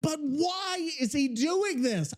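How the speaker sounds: high, drawn-out pleading; real fed-up disbelief; exasperated. Real, not acted. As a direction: wail it in baffled frustration. Cannot fix the generated voice.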